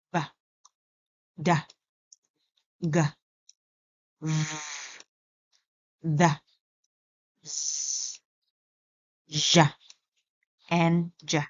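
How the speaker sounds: B, D, G said as voiced consonants; teacher demoes voiced consonant sounds. Shown as a demonstration. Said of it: "about the voiced".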